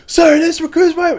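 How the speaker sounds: high, loud recruit bark, imitated; recruit's shouted reply. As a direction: sound off like a recruit at inspection. Recruit answering drill instructor at inspection.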